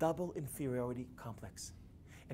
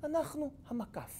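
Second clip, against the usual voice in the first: put-on high, light Yiddish-accented quip; 12 semitones higher than usual. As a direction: say it like an old Yiddish speaker. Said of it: "he answered with a Yiddish accent".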